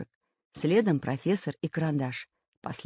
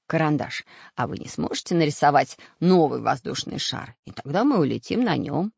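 ordinary delivery

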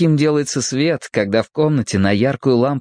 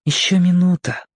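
put-on high-pitched boy thinking; boy's inner voice; 7 semitones higher than usual. A narrator's reading. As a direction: voice a boy's private thought, pitched up. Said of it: "подумал мальчик". (мысли мальчика, высоким голосом)

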